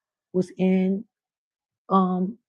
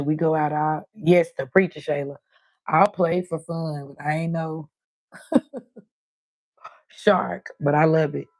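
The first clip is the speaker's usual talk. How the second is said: normal talking voice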